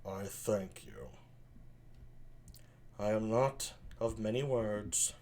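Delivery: low, soft, slow villain; Don John. Acted. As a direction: sullen prince of few words. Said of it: "Here's Don John".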